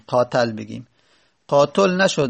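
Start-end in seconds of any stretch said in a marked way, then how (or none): 0.1-0.5 قاتل said "qaatal", a in the last syllable; fatha on the ta. Shown as a demonstration. Teacher recalling the failed first option, fatha on ta. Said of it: "فتحه بدیم". 1.5-2.0 قاتل said "qaatol", rounded o before the l; damma on the ta. Shown as a demonstration. Teacher recalling the failed second option, damma on ta. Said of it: "دمه بدیم".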